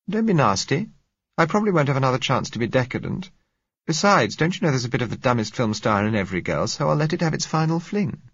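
put-on young woman, pitched well up, teasing; playful high female voice, quickened; Josella voiced. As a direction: tease him lightly, high and quick. Josella's lines, voiced higher.

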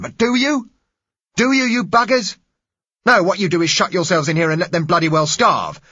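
loud, high, furious outcry; violent passionate plea. Acted. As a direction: scream it at them, outraged. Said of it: "The man's voice was violent".

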